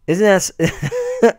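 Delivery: slow, half-laughed marvel; drawn-out amused wonder; laughing in disbelief. Real, not acted. Amazed at his own point.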